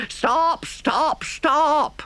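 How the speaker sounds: high shouts imitating pursuers; three urgent high cries; shouting. A narrator's reading. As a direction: shout it like a chasing crowd. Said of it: "all shouting the same thing".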